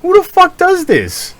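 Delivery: high-pitched mock outrage; exasperated disbelief. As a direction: squeal it in disbelief. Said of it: "I'm like".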